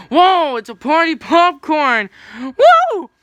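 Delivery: loud, high delighted cheer; excited. Acted. Character finding party popcorn, shouting with delight. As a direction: burst out with joy.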